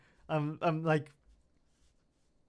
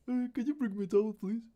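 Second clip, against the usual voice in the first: sheepish request; 11 semitones higher, faster than usual. Retold or imitated. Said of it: "texting my girlfriend, like".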